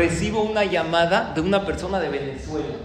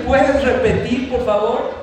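"Puedes repetir" lento, fuerte y agudo; petición recalcada; 6 semitones higher, 6 dB louder, slower than usual. Retold or imitated. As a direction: recalca "repetir, por favor" para que lo oiga otra persona. Asks caller to repeat for wife. ("Puedes repetir, por favor" alto y recalcado)

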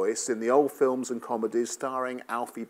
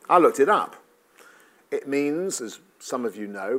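ordinary talk

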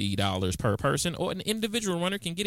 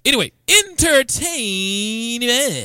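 slow announcer voice; 5 semitones higher, 6 dB louder, much slower than usual. Acted. Announcing the segment.

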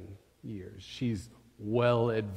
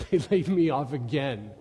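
bitter laughing father; 3 semitones higher, 8 dB louder than usual. Acted. Father voiced laughing at layoff.

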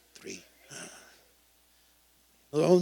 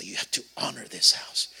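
whispered line; 5 semitones lower than usual. Real, not acted. Sermon point.

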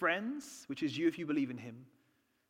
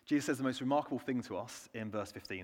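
normal talking voice

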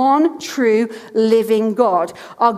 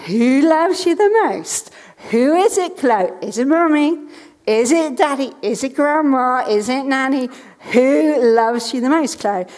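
put-on higher, sing-along parent-to-child questioning; playful quizzing; 3 semitones higher than usual. Mother quizzing small daughter in a daily game, playful teasing. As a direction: draw out the question like a family guessing game. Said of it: "she's into games".

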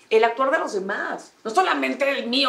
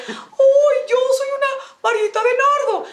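much higher and louder, a prissy mock voice; mocking falsetto; 5 dB louder, slower than usual. Mocking the coworker who boasted of being slender, high falsetto. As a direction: squeal it like a vain coworker showing off.

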